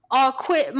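Australian accent attempt. Shown as a demonstration. Host trying an Australian accent on a dare. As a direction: try Australian, it slides British. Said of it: "Show me an Australian accent right now".

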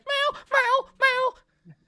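squeaky high mock meows, loud; cat meowing. Imitating the cat after being kicked across the room. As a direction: whine like an offended cat. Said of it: "He's like".

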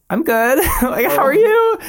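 high, strained small talk breaking into laughter; nervous cheeriness. Retold or imitated. Nervous kid answering mom before coming out, mock nervous cheeriness.

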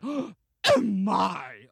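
loud theatrical shock; gasp, then stretch it out grandly; hammed-up scandalised host; affronted gasp. Affronted staff member gasps.

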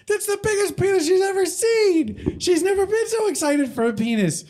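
very high, excited cartoon pony voice; squeaky Pinkie Pie. Friend imitating an overjoyed cartoon pony.

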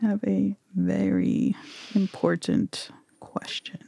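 slow, breathy whisper. Acted. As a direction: whisper it like a secret. Whispered message to caller.

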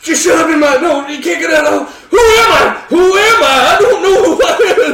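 high, loud panicked cries. Acted. Patient in a panic attack, high loud panic. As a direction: lose it in full panic.

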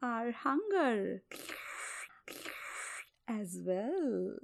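scheming tiger. Acted. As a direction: coax the bear slyly. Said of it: "The tiger thinks he has a chance".

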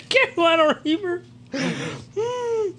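high giggling; laughing. Real, not acted. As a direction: giggle out the name. Laughing at pepper name.

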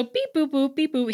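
high, playful robot beeping; light, high beeps and boops; little robot noises. Retold or imitated. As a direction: mimic a kid's robot sounds. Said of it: "making little robot noises".

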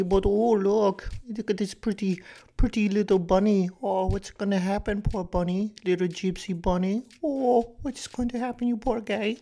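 very high put-on painter voice; Hitler Bob Ross. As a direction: coo over a little painting in a high, accented falsetto. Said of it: "Hitler Bob Ross has developed".

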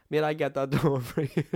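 high, loud laughing put-on voice of the helpful stranger; mock Irish helper. Retelling the door holder to a friend, laughing mock voice. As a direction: ham up the eager helper through laughter. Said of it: "He was like".

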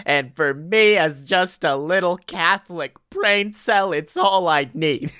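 slow comedian impression with swooping pitch; put-on stand-up cadence, slowly; John Mulaney. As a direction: draw it out like a stand-up setup. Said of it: "that's the John Mulaney brain cell".

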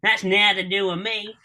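the phrase in genuine Hull accent; Northern accent. Shown as a demonstration. Northerner correcting southerner's attempt, real Northern accent. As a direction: correct it into proper Northern speech. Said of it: "not Northern".

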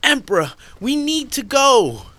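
high, loud urgent warning. Acted. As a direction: hurry him away, alarmed. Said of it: "Xena says".

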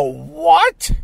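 high, rising mock disbelief; put-on astonishment; feigned shock. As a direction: act shocked, pitching it up. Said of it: "I'm making believe I'm shocked".

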